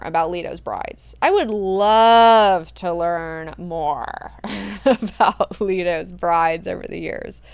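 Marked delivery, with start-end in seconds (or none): 1.2-7.4 slow, laughing, louder; laughing, drawn out. Real, not acted. Host repeats her wish, laughing slowly. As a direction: savour it through a laugh.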